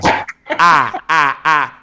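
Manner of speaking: rhythmic stuttered "I" like a chant; remix version. Shown as a demonstration. Host giving a remix of the declaration, rhythmic. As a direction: punch each "I" on the beat. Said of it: "give you the [name] remix version".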